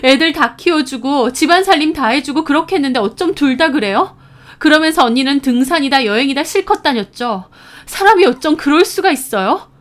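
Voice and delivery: heated, high-pitched scolding; angry reproach. A narrator's reading. Sister scolding sister-in-law about their mother, angry reproach. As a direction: lay into her with outrage.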